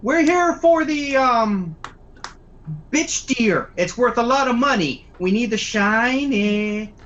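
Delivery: high, dopey thug voice; dumb goon voice. Acted. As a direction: play a dim-witted crook. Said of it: "The other guy's like".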